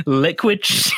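words cut off by a laugh; trying not to laugh; breaking into laughter. Real, not acted. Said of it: "You didn't do a very good job of composing yourself".

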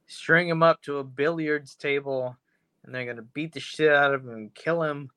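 ordinary talk

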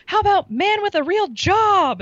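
imitating the host, very high and drawn out; high slow mock. Host calling out to the crowd, high and slow. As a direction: stretch it out in a squeaky mock voice. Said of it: "she's like".

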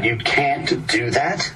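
slow, disbelieving retold question; incredulous. Director to actor who refused the improv, retold, incredulous. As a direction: draw it out, rising in mild disbelief. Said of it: "looked at me like I had four heads".

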